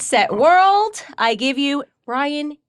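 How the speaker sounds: big ceremonial introduction, high and loud on the launch, slowing into the name; announcer countdown. Real, not acted. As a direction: launch the guest like a race starter turned show announcer. Host ceremonially launches guest's message.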